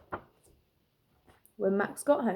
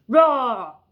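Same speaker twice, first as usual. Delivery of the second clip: loud child's attempt at a roar; little boy's roar; 2 semitones higher, 11 dB louder than usual. Max showing T-Rex how loud he roars. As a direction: roar as big as a six-year-old can. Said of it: "Here's how loud I can roar".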